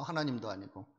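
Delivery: ordinary talk, nothing marked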